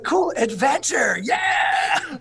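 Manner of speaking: high, loud, drawn-out cheer; excited shout. Retold or imitated. As a teen sold on the Army, shouting with excitement. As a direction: whoop it like a thrilled teenager. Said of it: "So I was like".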